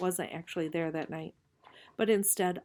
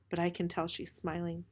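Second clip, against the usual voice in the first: usual speaking voice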